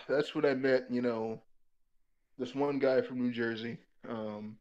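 ordinary talk

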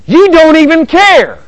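high, loud, drawn-out accusation; upset spouse. Acted. Spouse accusing partner of not caring, loud and upset. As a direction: wail it at your partner, slow and high.

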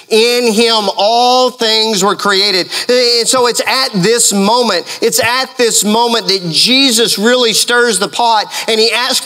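high, driving sermon delivery; impassioned preaching. Acted. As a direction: preach it high and insistent, stretching the key words. Preaches at full force, voicing Jesus' challenge.

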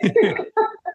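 high, light laugh; amused laugh. Real, not acted. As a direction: laugh off a pile of questions. Laughing at host's many questions.

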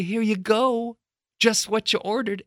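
high, cheery delivery person; good delivery driver. Acted. Driver at the customer's door, cheery service. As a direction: hand it over in a high singsong. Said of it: "and say".

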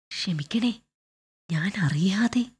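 soft, hushed apologetic whisper; whisper in ear. Acted. Clerk apologizing into general's ear at the play, whispering. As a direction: plead under your breath, close to his ear. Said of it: "പതുക്കെ ചെവിയിൽ പറഞ്ഞു".

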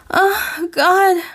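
high, loud cry of pleasure; moaning cry. Acted. Ray crying out as Ben thrusts, high moaning cry. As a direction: let it break out high and loud as a moan. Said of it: "she moaned".

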